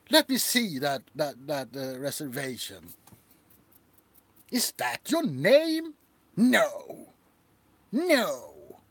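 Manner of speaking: a prissy owner appalled by a guest; snooty hotel owner. Pretend. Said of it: "a hotel owner".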